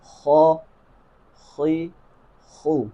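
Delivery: خو خی خو each said separately with moderate fullness; kha vowel drill. Shown as a demonstration. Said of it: "ایک مرتبہ دوبارہ اسے پڑھتے ہیں". (خو خی خو مشق)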